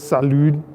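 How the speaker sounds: "salud" with the D sounded out; mispronounced. Retold or imitated. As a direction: say "salud" ending on a clear D. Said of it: "you pronounce the D instead of the silent T".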